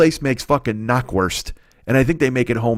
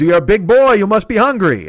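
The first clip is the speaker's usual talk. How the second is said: put-on loud, high, hearty old shopkeeper; old deli man; 7 semitones higher, 5 dB louder than usual. Deli owner teases a big customer, hearty and high-pitched. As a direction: size him up loudly as the old deli man. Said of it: "And he goes".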